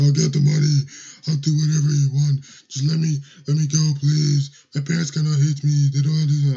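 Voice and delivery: high, very loud desperate wailing plea; panicked begging kid. Retold or imitated. Scared boy begging the superintendent, loud high wailing plea. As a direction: cry out in frantic begging.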